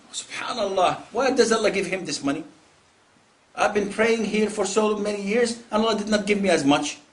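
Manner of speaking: envious complaint. Acted. Acted envier resenting another's money.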